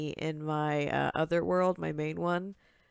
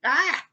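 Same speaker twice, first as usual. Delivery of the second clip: startled yelp; 10 semitones higher, 6 dB louder than usual. Real, not acted. Startled by a mob.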